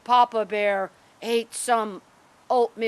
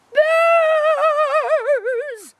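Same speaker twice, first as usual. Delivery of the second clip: very high, long loud scream; great big voice; 10 dB louder than usual. A narrator's reading. Bernadette terrified of the bears, great big high scream. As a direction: scream it out huge and drawn out. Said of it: "in a great big oki".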